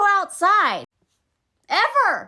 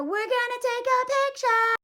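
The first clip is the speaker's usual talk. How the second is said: higher, put-on voice, whispered "ready"; sing-song photo voice; 8 semitones higher than usual. Mom about to snap Gizmo's picture, cooing then whispering. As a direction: coo it like a parent posing a shot.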